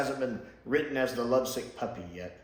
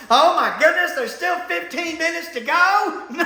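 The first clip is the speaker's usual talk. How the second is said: much higher and louder, laughing in disbelief; horrified there's so much left, laughing; laughing dismay; 14 semitones higher, 7 dB louder than usual. Retold or imitated. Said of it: "My second thought was".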